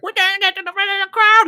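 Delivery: high, fast, airy mock voice of a drunk girl; mocking the girl pushing to the front; mimicking drunk girl. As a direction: parody her breezy excuse. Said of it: "she's like".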